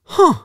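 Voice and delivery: a short high dismissive "huh". Retold or imitated. Imitating the author snubbing him, high dismissive huh. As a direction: brush him off with one high grunt. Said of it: "it went".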